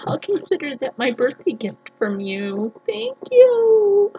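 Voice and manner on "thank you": high, louder, overwhelmed thank you; emotional thanks. Real, not acted. Vlogger thanking the shop owner, overwhelmed and loud. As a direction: call out your thanks, moved.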